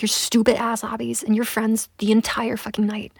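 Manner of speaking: normal talking voice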